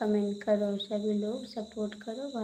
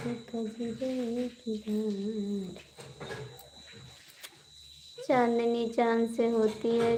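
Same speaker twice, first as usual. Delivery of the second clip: poetic recitation. Acted. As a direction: recite it like a heartfelt couplet to your viewers. Reciting a shayari couplet.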